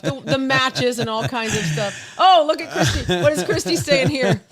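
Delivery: ordinary talk, nothing marked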